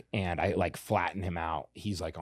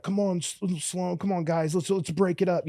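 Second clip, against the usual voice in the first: higher-pitched onlooker pleading to stop; imitating bystanders; 9 semitones higher than usual. Fighter quotes onlookers at a street fight, imitated pleading. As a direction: mimic a bystander breaking up a fight. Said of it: "Some of 'em are like".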